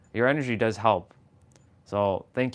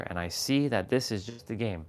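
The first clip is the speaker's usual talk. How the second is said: usual speaking voice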